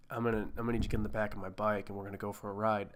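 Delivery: low, quick, soft mock tough guy; lightly imitated biker invitation; Charlie Hunnam. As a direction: murmur it fast and low as the biker. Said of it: "if he came up to me, he's like".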